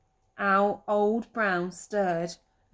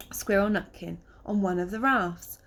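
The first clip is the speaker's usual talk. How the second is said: ordinary delivery, nothing marked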